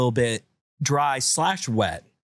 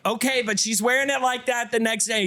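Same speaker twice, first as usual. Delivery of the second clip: higher, grudging concession; mom conceding; 8 semitones higher than usual. Retold or imitated. Mom grudgingly conceding to dad, higher. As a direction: give in but set a condition.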